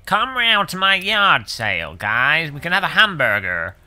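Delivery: jokey fake American accent; mock American accent. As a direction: play a cheesy American inviting everyone over. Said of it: "that's how they talk in America".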